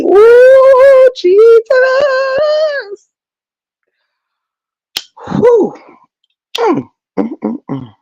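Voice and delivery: high ooh, Jesus with sobby hums; real high outcry. Real, not acted. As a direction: cry out high, overcome. Overcome exclaims.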